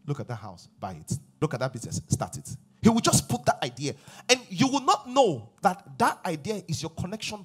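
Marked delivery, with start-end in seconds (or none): 0.1-2.6 a hushed nudge from inside; gentle inner prompting. Retold or imitated. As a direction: lower and softer, like a quiet thought. Voices God's quiet prompting.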